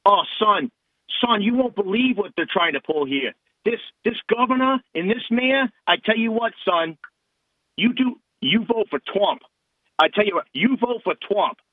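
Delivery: imitating a Long Island local giving friendly advice; Long Island accent. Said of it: "I'll use the Long Island accent".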